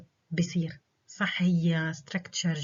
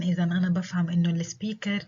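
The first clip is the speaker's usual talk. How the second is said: usual speaking voice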